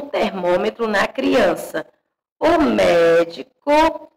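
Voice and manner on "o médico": "O médico" spelled out very slowly, "mé" leaned on; teacher dictating "médico" slowly to point out its acute accent; slow dictation. Shown as a demonstration. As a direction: stretch "O médico" so the class hears the stressed "mé". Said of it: "olha o acento agudo no E".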